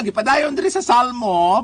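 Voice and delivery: ordinary talk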